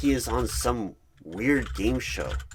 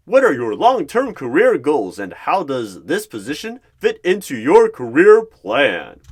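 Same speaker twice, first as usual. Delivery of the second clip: big, loud, higher game show host; boastful game show voice; 6 semitones higher, 14 dB louder than usual. Acted. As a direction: boom it out like a cheesy quiz host. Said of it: "big, boastful voice like he is on some weird game show".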